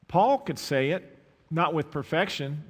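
loud preacher's push; sermon emphasis, full voice; preaching. Acted. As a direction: drive it home to the congregation. Preaching peak.

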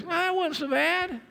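high, slow, breezy doctor voice; a mock-casual doctor shrugging it off. Preacher mimics his doctor after a painful knee shot, mock breezy and high. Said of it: "And he goes".

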